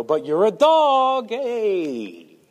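slow, mock-casual insult; a lightly joking dismissal. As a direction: toss off the insult as a joke. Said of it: "with a joking tone".

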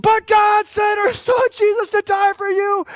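high, loud revival scream. Retold or imitated. Pastor reenacting Chandra screaming at a demon-possessed man. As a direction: belt it out like a street preacher. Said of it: "And she screamed".

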